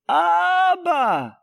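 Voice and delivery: high call of Abba across the plane; child calling out. Retold or imitated. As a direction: cry out like a little boy for his dad. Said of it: "at the top of his lungs".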